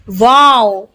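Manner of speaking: usual speaking voice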